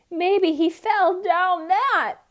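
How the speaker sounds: high, loud, drawn-out wail; wailing cat. A narrator's reading. Cat fearing duck fell off cliff, wailing. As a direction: wail it in distress. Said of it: "wailed the cat".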